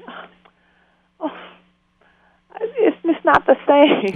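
high, slow, loud wail of disappointment; mock lament. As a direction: act out a drawn-out wail, half laughing. Acts out missing his voice at movies.

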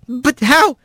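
high-pitched, bewildered stammering questions; high voice, too stunned to finish; flustered Rat. A narrator's reading. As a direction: stumble from one startled question to the next. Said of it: "he finally got out".

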